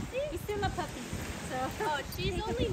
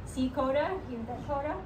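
soft coaxing; 4 semitones lower, 5 dB quieter than usual. Real, not acted. Coaxing the nervous dog.

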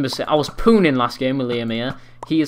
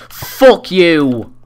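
shouted curse, high and loud; angry yell; 4 semitones higher, 11 dB louder than usual. Real, not acted. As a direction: yell it at the screen. Raging at opponent.

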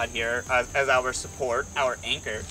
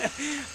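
soft amused chuckle; a quick laugh at the rig; short laugh; 11 semitones higher, 5 dB quieter than usual. Real, not acted. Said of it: "I'm just laughing at the system".

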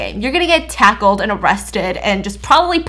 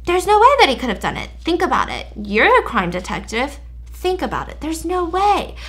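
higher, fast and gloating innocent act; smug nerdy boy; 5 semitones higher than usual. Retold or imitated. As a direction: rub it in like a smug suspect. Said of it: "And Ian's like".

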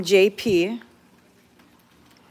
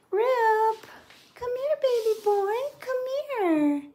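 high, sing-song call to the cat; high cooing call; 12 semitones higher than usual. Real, not acted. Woman coaxing her cat Rip in a high baby voice.

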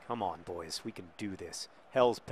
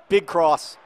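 calling out; 5 semitones higher, 10 dB louder than usual. Real, not acted. Calling play in match.

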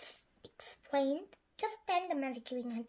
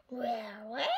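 croaky frog voice; 4 semitones lower, 5 dB quieter than usual. Acted. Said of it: "croaked the frog".

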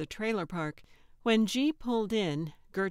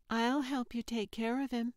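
a hint of a higher child's voice; lightly voiced little girl; 5 semitones higher than usual. A narrator's reading. Sophia to Carl about the cat, lightly childlike. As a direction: offer help in a light kid voice.

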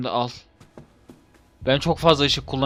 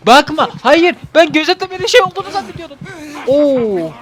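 panicked yelp; 11 semitones higher, 5 dB louder than usual. Real, not acted. Panicked while chased in game.

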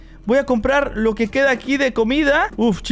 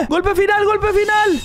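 higher, urgent hype; emoción de combate; 5 semitones higher than usual. Real, not acted. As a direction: cheer on the last blow. Anima el último golpe del combate. (grito de ánimo, agudo y emocionado)

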